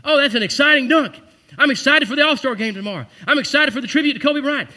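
imitating gushing TV commentators; mock excited gushing. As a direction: ham up the sports fan excitement. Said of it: "every other word".

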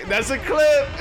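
shouting it, way up high; hype shout. Real, not acted. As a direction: yell it out in hype. Hype over winning odds game.

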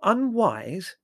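high, loud indignant query; outraged questioning repeat; incredulous echo. Acted. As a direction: throw the word back in disbelief. Incredulous echo of mother's word.

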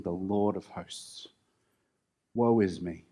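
understated quiet lament; a hint of grief. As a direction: speak it as a broken man, lightly. Isaiah crushed before God.

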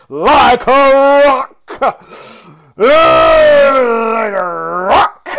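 slow, over-the-top belted emphasis; drawn-out growling shout. Real, not acted. Podcaster playfully repeating the guarding line, drawn-out roar. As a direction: stretch it out into a huge, high, loud roar. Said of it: "I don't know why I said it like that".

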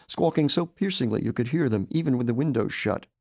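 plain reading voice

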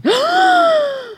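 a sharp, high gasp of surprise; shocked gasp. Real, not acted. Shocked reaction to Santa idea.

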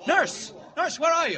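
loud, high shout; alarmed yell down a corridor; urgent calling out. Acted. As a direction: holler for someone out of sight. Kent calls for nurse.

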